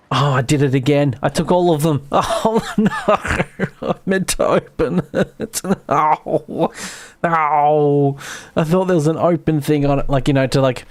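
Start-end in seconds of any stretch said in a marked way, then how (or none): 2.1-8.2 laughing through oh no; giggling at her own slip. Real, not acted.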